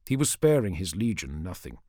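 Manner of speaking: ordinary delivery, nothing marked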